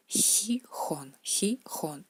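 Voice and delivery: Gijón con la J rusa áspera; Russian kh sound. Shown as a demonstration. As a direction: marca la J de Gijón en la garganta. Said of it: "es la letra J que da el sonido G". (Gijón, J fuerte como en español)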